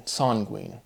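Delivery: "Sanguine" with first syllable like "sahn"; word-form play. Shown as a demonstration. Speaker toying with word forms, "Sanguine" said softly as SAHN-gween. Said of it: "emphasizing the word forms".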